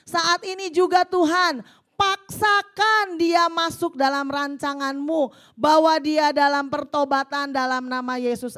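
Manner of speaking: loud, impassioned prayer voice read to the congregation; fervent prayer reading. Acted. Preacher reading a wife's prayer aloud, fervent and loud. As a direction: cry out the prayer like a battle cry.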